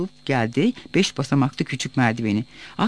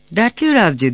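raised, louder call; 4 semitones higher, 5 dB louder than usual. A narrator's reading. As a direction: call out as if to wake someone. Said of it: "diye bağır". (seslenerek çağırma)

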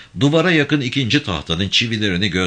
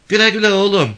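high, loud motherly goodbye; mother's high-pitched send-off; village mother's farewell; 6 dB louder, faster than usual. A narrator's reading. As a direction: call a farewell after a departing rider. Mother sees the rider off.

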